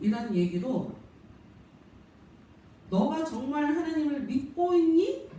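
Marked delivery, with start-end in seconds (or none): 2.9-5.3 high, slow voice of a questioning devil; devil's slow, high-pitched challenge to faith; tempting devil. Acted. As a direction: cast doubt as the devil, drawn out and pitched up. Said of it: "마귀는".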